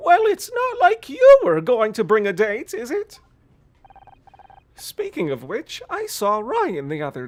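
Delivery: needling mother, high and loud; old lady voice. Acted. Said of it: "old lady voice".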